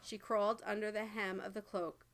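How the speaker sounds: ordinary delivery, nothing marked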